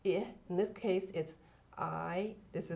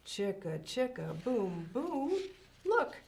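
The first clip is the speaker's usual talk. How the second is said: lively, sing-song storybook reading; reading to children; 4 semitones higher, slower than usual. Acted. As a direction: read it like a bedtime picture book. Reads picture book aloud.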